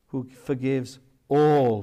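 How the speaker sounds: slow, with the word "all" drawn out and weighted; stress on all. Shown as a demonstration. Preacher to congregation, stressing "all" slowly. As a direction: lean hard on "all" and take it slowly. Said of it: "with the emphasis on the word all".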